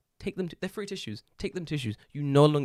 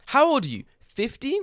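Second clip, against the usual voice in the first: high, loud, rising questions; mock sales pitch; 12 semitones higher, 9 dB louder than usual. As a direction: pitch it up like an eager tout. Mimicking the school's adult-class pitch.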